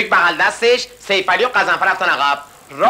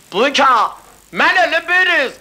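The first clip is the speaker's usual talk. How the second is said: higher hawker's call; mock hawker call; 9 semitones higher, slower than usual. Cigarette seller calls to Buyuk, higher mock call.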